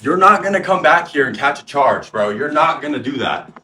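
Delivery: loud heated reenactment of a standoff; retold screaming. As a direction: shout him down. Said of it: "and I'm screaming".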